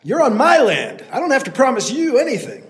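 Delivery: loud, high; yelling. Acted. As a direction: keep hollering. Landowner keeps yelling.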